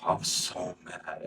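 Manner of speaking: soft, slow, low-key fuming; quiet, spent anger; subdued repeat. Real, not acted. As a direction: say it drawn out under the voice. Same words repeated quietly after interruption.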